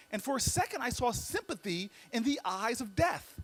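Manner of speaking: ordinary delivery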